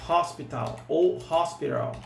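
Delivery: ordinary delivery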